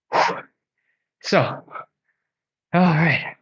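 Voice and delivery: panting between words after exercise; winded, catching breath. Real, not acted. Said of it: "Man, I'm out of breath".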